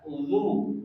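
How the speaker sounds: uzu with lips rounded and pushed out; teacher modelling uzu; lips pushed forward. Shown as a demonstration. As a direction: round the mouth fully and push the lips out. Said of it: "Ingat, mulutnya sempurna, monyongnya".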